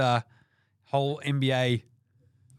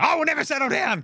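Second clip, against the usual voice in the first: high-pitched, defiant mock yell; mock angry outburst; 12 semitones higher, faster than usual. Host imitates angry player refusing to settle down, high mock outburst. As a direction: snap back high, refusing to calm down. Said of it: "I'm always angry".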